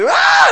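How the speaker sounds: piercing high war cry; battle scream. Retold or imitated. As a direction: howl as the warriors rush. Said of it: "the Irish Celtic show".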